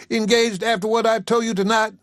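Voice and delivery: high, incredulous outrage; a jilted rival in disbelief; outraged Isaac. Acted.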